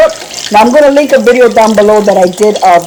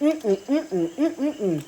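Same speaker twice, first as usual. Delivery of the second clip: happy yummy hum; 3 semitones higher, 14 dB quieter, much slower than usual. Real, not acted. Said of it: "my happy dance".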